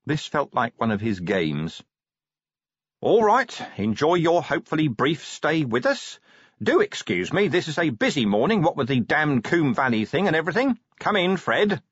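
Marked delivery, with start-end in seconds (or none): 3.0-11.8 higher-pitched, hearty brush-off; busy commander, dismissive. A narrator's reading. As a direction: get rid of the visitor politely. Vimes briskly dismissing the visitor.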